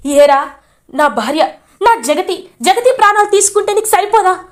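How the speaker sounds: high, loud accusing rant; furious confrontation. Retold or imitated. Mahendra confronts Shailendra over his wife's death, furious yelling. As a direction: demand answers, voice rising to a yell. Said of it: "గట్టిగా నిలదీస్తాడు".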